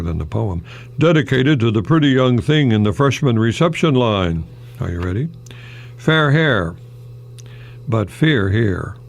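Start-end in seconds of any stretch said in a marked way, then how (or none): none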